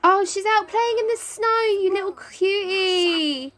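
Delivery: high-pitched, delighted cooing; sing-song doting gush; adoring cooing. Real, not acted. Cooing over child in snow.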